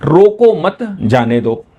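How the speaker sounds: "रोको मत" run together, break, then "जाने दो"; group "रोको मत" as one, pause before "जाने दो"; pause after मत. Shown as a demonstration. Teacher showing how a gap changes meaning, pause after "मत". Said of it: "इसमें जो गैप है".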